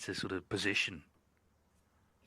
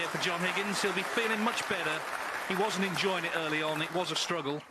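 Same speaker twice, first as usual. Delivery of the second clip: rising excited commentary, higher and louder; 8 semitones higher, 5 dB louder than usual. Real, not acted. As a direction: lift it as the balls go in. Crowd cheers, Higgins back in.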